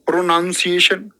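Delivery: प्रोनाउंसिएशन with a full "pro-noun" opening; wrong pronunciation. Shown as a demonstration. Teacher mimicking students' mistake, प्रोनाउंसिएशन said with "noun". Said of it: "गलत उच्चारण है".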